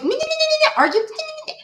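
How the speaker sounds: very high, squeaky mimicry of an arguing kid; mock the back-talk in a tiny high babble; mocking whiny imitation.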